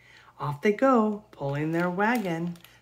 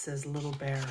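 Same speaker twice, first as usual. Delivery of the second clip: ordinary delivery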